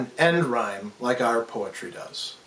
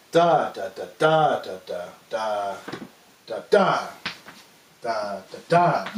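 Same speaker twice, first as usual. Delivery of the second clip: "da" beats regrouped, strong ones held long with breaks; "da, da" with the stress shifting between groups; changed accent; 5 semitones lower than usual. Shown as a demonstration. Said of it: "we might change the accent up".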